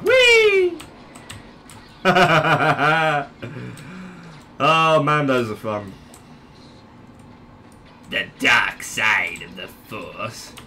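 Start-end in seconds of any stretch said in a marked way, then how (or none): 0.0-0.8 very high, loud squeal; playful whee. Pretend. Gamer flying up and over, high gleeful whee. 8.1-10.5 low, slow dark-lord delivery; villain voice. Pretend. Gamer quoting Star Wars while playing, low villain voice.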